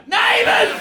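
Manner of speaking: high-pitched exasperated yell; exasperated outburst. Acted. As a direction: cry it out in comic disbelief. Mock outrage at soap's return.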